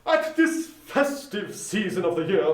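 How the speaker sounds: lightly voiced charity gentleman; polite portly gentleman. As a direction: greet him with formal politeness. Gentleman continues.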